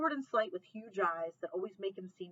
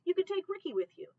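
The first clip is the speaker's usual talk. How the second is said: pushy suggestion; 10 semitones higher, 4 dB louder than usual. Acted. Grandma pushes a suggestion.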